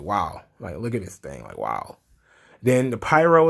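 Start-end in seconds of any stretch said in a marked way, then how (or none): 0.0-2.0 low, hushed voice of the awestruck wrestler. Retold or imitated. Wrestler holding the new belt, low in awe. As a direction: breathe it out low in wonder. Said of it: "L A Knight's like".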